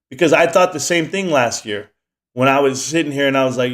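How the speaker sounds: ordinary talk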